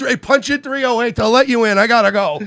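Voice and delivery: loud, high, panicky re-enactment; mock-panicked brush-off; flustered escape. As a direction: blurt it out loudly to get away. Acts out hurrying away from lawyer.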